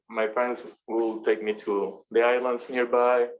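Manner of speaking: usual speaking voice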